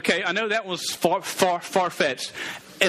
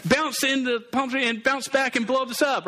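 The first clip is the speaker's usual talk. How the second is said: high-pitched, urging on a hero; urgent crowd plea; 6 semitones higher than usual. Acted. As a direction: call out higher, a crowd pleading for action. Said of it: "everybody's like".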